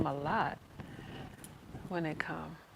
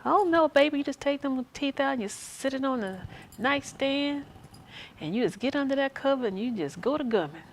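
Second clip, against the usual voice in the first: put-on higher and louder, folksy old lady giving advice; old nursing home resident; 7 semitones higher, 4 dB louder than usual. Nursing home elder advising a young worker, higher and hammed-up. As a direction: play a cheeky old resident sharing a secret. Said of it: "old people telling you all kind of little crazy stuff".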